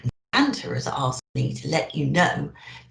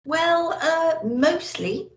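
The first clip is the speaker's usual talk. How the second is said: put-on little old elf; 10 semitones higher, much slower than usual. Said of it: "answered the little old elf".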